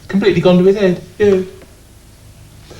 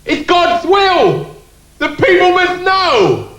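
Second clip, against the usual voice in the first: mock grandiose; slower than usual. Grand mock-solemn pronouncement.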